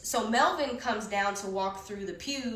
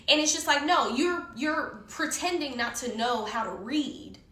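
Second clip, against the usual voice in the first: ordinary talk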